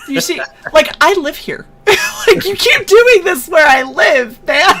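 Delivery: high, whining mock protest; mock exasperated. Protesting to friend who embarrassed her where she lives, mock exasperated. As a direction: plead with rising, half-laughing exasperation.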